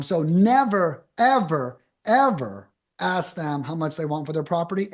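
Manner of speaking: coach's firm warning, never ever; stress on never. Real, not acted. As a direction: lean hard on never and ever.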